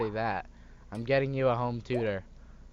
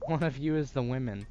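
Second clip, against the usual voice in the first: ordinary talk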